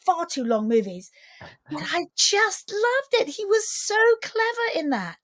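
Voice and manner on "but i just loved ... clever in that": high-pitched, enthusiastic gush; gushing admiration. Real, not acted. Guest raves to host about an actor's performance, gushing. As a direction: rave about it, pitched well up.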